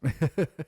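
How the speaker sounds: sudden high loud laugh; loud burst of laughter. Real, not acted. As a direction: let out a big laugh. Laughs at own joke.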